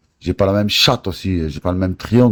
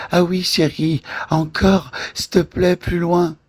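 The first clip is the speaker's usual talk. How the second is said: mocking sister voice; 13 semitones higher than usual. Taunting him by mimicking his sister, high and mocking. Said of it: "c'est pas la même voix".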